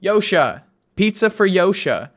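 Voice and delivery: waitress calling out an order; retold calling out. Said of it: "keep coming out and shouting".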